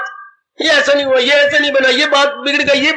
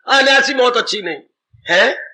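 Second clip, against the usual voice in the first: normal talking voice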